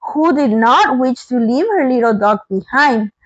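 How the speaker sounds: usual speaking voice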